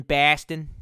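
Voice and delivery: Boston said as pæstən, flat Boston a; Boston accent repeated. Shown as a demonstration. Repeating Boston to chat, mock Boston accent. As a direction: say Boston again with a broad Boston accent.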